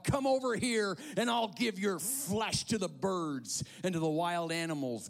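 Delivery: loud, high yelled threat; Goliath. A narrator's reading. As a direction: yell it as a menacing challenge. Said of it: "Goliath yelled".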